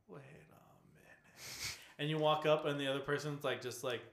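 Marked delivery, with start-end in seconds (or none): 0.1-1.8 slow suspicious whisper; hushed realization. Retold or imitated. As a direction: breathe it out, suspicious. Noticing suspicious NPC.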